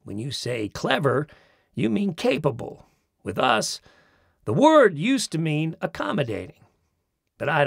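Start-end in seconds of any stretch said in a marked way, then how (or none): none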